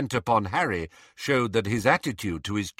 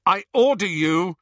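higher, louder command cut off; fierce order; 8 semitones higher, 6 dB louder than usual. A narrator's reading. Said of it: "said Harry fiercely".

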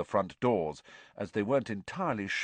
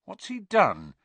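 high-pitched nervous question; uneasy, lifted in pitch; 11 semitones higher, 4 dB louder than usual. A narrator's reading. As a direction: ask anxiously, voice pitched up. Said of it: "said Harry nervously".

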